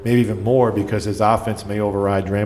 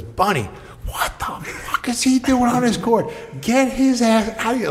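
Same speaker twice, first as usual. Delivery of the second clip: high-pitched outraged rant, retold; mock tirade, voice pitched way up; irate coach going off; 13 semitones higher than usual. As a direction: imitate a coach exploding at a trainer. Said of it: "just goes totally off me".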